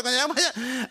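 usual speaking voice

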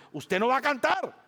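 high, loud, fiery rebuke; preaching at full force. Real, not acted. Pastor scolding a congregation that will not sing, fiery. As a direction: thunder it from the pulpit.

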